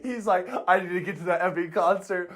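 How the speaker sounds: mock the driven drummer through laughs; giggling mock obsession; laughing imitation. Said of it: "his first thought is".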